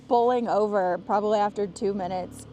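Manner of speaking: ordinary talk, nothing marked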